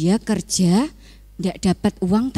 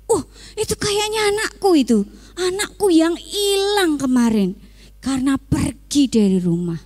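much higher, fast and tearful; joyful father; 5 semitones higher than usual. Acted. A father seeing his lost son return, tearful and joyful. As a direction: cry out with joy at seeing him.

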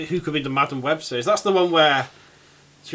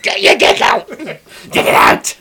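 loud, high hissed goblin yell; mock goblin shriek; 12 semitones higher, 10 dB louder, slower than usual. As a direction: shriek at Norman. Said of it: "goblin voice".

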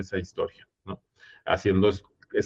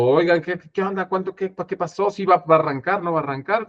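retold shouting; 7 semitones higher than usual. Director in the booth during the blackout, retold shouting. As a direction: imitate calling out urgent questions in a high voice. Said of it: "yo estoy en cabina gritando".

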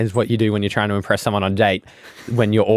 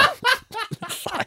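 high laugh; 7 dB quieter than usual. Real, not acted.

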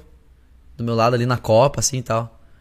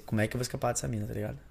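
quick inner thought; 4 semitones lower, 13 dB quieter, faster than usual. Retold or imitated. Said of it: "Aí pensei".

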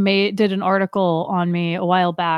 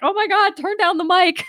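high-pitched, hammed-up protest at the din; mock alarmed plea; 11 semitones higher, 3 dB louder than usual. Diver reacting to kids screaming in her ear, mock alarm. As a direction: exclaim it high as if deafened, playfully.

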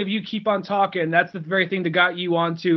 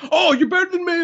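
mock offended outburst; 10 semitones higher, 3 dB louder than usual. Imagined offended stranger picking a fight.

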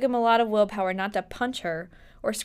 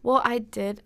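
ordinary talk, nothing marked

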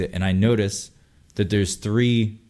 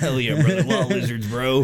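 mock hype bro; 3 semitones higher than usual. Jokey hype reply.

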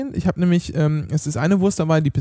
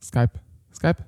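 imitating echo; 8 semitones lower, 4 dB quieter than usual. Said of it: "Echo-Effekt".

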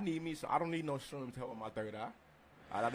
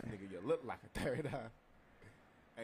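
laughing; 6 dB quieter, much slower than usual. Real, not acted. Repeats quote while laughing.

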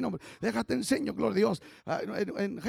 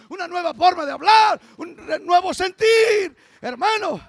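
preaching at full force; 4 semitones higher, 8 dB louder than usual. Acted. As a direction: proclaim it with everything you have. Preaching crescendo.

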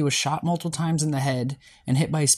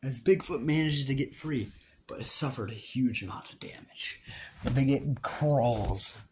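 story narration; 6 dB quieter than usual. Acted. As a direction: tell the fight like a story. Narrates the battle scene.

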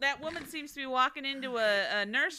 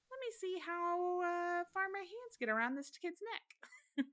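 creepy imitation; 8 semitones higher, 7 dB quieter than usual. Voicing the killer.

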